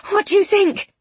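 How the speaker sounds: high-pitched, worried asking; anxious woman's question. A narrator's reading. Miss Eyre asking about her unconscious friend, anxious.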